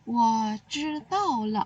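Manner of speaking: Wo zhi dao le said very slowly, each syllable clear; slow example phrase. Shown as a demonstration. Teacher models a Mandarin phrase for pupils, very slowly. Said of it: "kamu seperti bilang".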